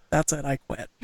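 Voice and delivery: mock defeat. Real, not acted. Host giving up on reading names, mock defeated mutter. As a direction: give up on the name list with a sigh.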